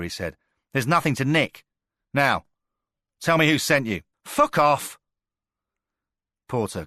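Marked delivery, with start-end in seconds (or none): 0.7-4.0 high, loud hard questioning; loud demand. A narrator's reading. Porter barks questions at the captured gunman, loud and fierce. 4.2-5.0 loud, high Scouse snarl; Scouse curse. A narrator's reading. Captured Scouser snarls at Porter in a loud Scouse accent.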